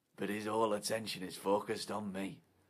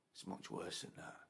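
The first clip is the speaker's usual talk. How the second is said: hushed aside; 4 semitones lower, 12 dB quieter than usual. Acted. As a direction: drop to a whisper for the build. Hushed build-up to punchline.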